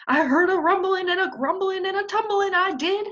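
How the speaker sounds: high, loud urgent telling; breathless hen. Acted. Hen insists she heard the stampede. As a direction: rattle off the rumbling. Mary repeats her news.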